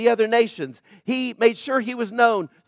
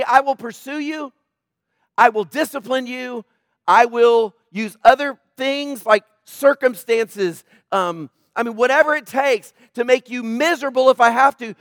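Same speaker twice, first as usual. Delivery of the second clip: raised, driving sermon voice speaking as God; preaching at full force; 4 semitones higher, 5 dB louder than usual. Acted. Preacher speaks as God to the congregation, impassioned. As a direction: press each promise like a pulpit climax. Preacher voicing God's pursuit.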